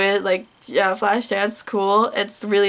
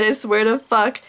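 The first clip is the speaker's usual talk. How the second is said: ordinary talk, nothing marked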